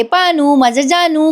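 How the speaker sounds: लाडिक हाक. Retold or imitated. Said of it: "करत". (लाडिक हाकेची हलकी नक्कल)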